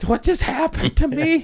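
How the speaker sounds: very high, dazed squeal; weird bewildered voice. Retold or imitated. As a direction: act out shocked confusion in a high voice. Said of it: "that weird, like".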